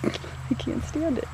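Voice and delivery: slow breathless whisper, too moved by the pet; whispered overwhelm. Real, not acted. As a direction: whisper it, almost in tears of joy. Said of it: "I'm almost crying".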